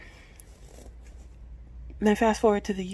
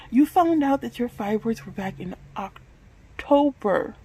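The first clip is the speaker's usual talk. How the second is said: angry self-scolding; 5 semitones higher, 3 dB louder than usual. Real, not acted. Confronting herself after the outburst.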